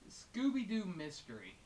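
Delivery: ordinary talk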